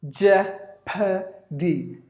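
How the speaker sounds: "Jeopardy" very slowly in three separate beats; syllable by syllable. Shown as a demonstration. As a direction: break "Jeopardy" into jep, uh, dee. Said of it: "There are three syllables in this word".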